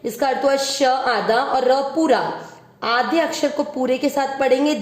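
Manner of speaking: usual speaking voice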